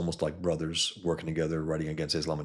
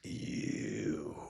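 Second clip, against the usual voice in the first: menacing hero; 7 semitones lower, 9 dB quieter than usual. Retold or imitated. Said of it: "then he turns around".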